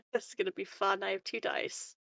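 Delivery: ordinary delivery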